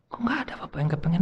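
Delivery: quick whispered tease. Real, not acted. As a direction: hush it fast as a sly aside. Teasing aside to audience. (bisikan cepat yang menggoda)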